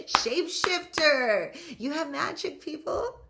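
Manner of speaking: loud, high, drawn-out hype shout; exuberant cheer. Real, not acted. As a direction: cry it out to fire up the crowd. Hyping up listeners after laughing.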